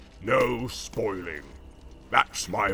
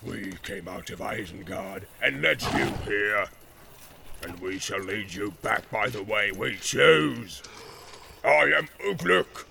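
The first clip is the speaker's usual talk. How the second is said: loud, slow boastful roar; roaring orc warlord; 5 dB louder, slower than usual. Acted. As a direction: boast like a war chief. Ugluk boasting, shouting.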